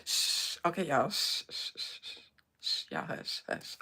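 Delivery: soft, whispered shushing; hushing. Real, not acted. Streamer settling giggly audience before prayer, soft hushing. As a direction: shush them quietly to settle down.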